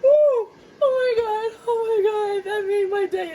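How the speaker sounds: high gleeful whoops after a laughing fit; excited squeal. Real, not acted. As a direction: squeal with delight.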